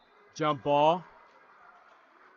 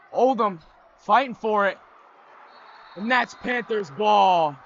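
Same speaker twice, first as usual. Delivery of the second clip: excited call; 5 semitones higher, 8 dB louder than usual. Real, not acted. Scramble for the loose ball.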